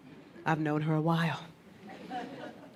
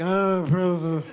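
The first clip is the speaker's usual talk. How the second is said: slow, louder, slurred-sounding princess; drunk princess; 4 dB louder, slower than usual. Pretend. Woman describing herself when drunk, slow louder princess voice. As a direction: play yourself tipsy and grand. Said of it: "then I'm like".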